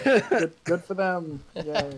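sarcastic cheer. Acted. Mock cheer for a letdown winner.

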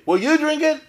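high, loud, pressing challenge; challenging question. Retold or imitated. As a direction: corner the rabbi with it, loud and high. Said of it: "You say it's okay".

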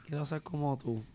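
lightly voiced kid, soft; a hint of a small, quiet child; child's plea. Guest replays the kid asking for advice, soft child voice. Voicing the ten-year-old child.

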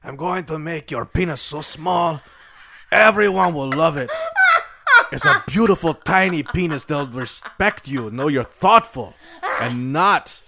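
a hint of an Italian accent; lightly voiced Italian sculptor. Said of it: "That's my Italian accent".

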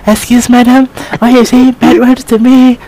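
high, loud Chinese-accented scolding; hammed-up indignant Chinese accent; angry Chinese accent. Shown as a demonstration. Friend parodying her rant at madam, angry Chinese accent. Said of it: "do it like a damn Chinese accent".

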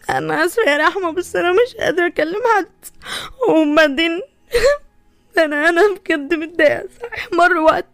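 tearful, breaking into high sobs; crying. Acted. Shahd telling Rahma she still grieves her sister, sobbing. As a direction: grieve for the sister through tears. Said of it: "انتي بتعيطي؟".

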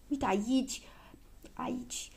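breathy half-whisper; hushed pointing out. A narrator's reading. Girl showing where the plaster goes, half-whispering. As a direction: softly show the exact spot. Patient shows the sore spot.

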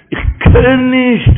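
loud, high, stretched refusal; drawn-out cry. Acted. Preacher voicing the grandson's refusal, high drawn-out cry.

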